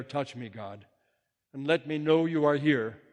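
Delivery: ordinary talk, nothing marked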